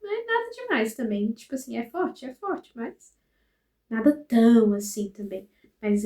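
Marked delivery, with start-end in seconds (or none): 0.0-1.3 much higher, brushing it off; breezy mock denial, pitched up; high dismissive. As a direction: wave it away in a high voice. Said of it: "Mas eu falei assim".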